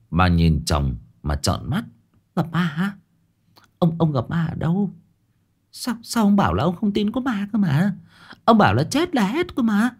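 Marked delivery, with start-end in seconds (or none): 2.3-9.9 high-voiced shaken woman recounting a nightmare; wife tells husband her nightmare, high and frightened. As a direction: tell him the dream still trembling. Wife voiced, alarmed questions.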